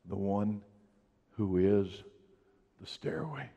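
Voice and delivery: hushed, tender reading of Jesus' words; soft scripture reading. Acted. Pastor reading scripture softly to the church, hushed. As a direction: let the promise settle in quietly.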